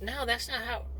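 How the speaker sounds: hint of a teenager cutting in; lightly voiced teenager. Parent mimicking a teenager who won't take explanations, lightly voiced teen. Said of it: "a teenager sucks, because they're like".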